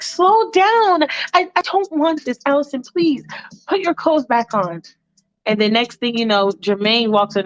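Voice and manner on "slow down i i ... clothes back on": high-pitched, anxious protest; pleading Michael. Acted. Michael stopping Allison undressing him, high pleading voice. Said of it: "And Michael is like".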